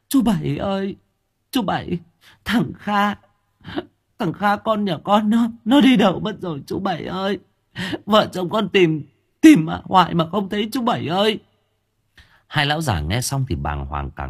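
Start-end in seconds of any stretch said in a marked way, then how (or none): 0.1-11.4 much higher, louder, tearful wail; a desperate mother begging for help, voice crumpling; whimpering plea. Acted. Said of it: "thì mếu máo".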